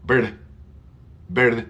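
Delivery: "bird" said as bair, with an open e, as a mistake; wrong "bird". Shown as a demonstration. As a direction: model the wrong vowel. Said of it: "we don't go".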